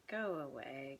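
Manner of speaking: soft go away. Real, not acted. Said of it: "this whole thing's coming up".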